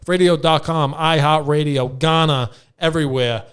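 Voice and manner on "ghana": usual speaking voice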